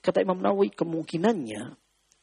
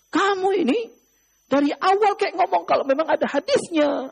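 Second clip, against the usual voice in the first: indignant scolding; 6 semitones higher than usual. Acted. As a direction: scold him for hiding the hadith. Jundub scolds the silent man.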